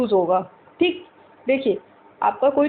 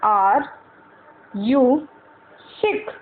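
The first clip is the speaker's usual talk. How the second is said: each word spaced out with pauses; slow drilled English, one word at a time; much slower than usual. Shown as a demonstration. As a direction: say it slowly for learners to repeat. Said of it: "मैं इतना धीरे धीरे बोल रही हूँ".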